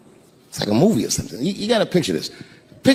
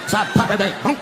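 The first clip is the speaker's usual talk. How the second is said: usual speaking voice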